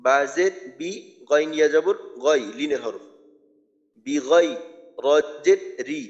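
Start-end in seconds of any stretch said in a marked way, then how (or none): none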